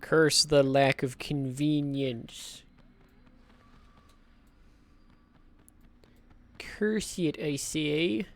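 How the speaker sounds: slow, mopey droopy cartoon dog. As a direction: drag it out like Droopy, glum and slow. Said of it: "I'm trying to do a droopy dog voice".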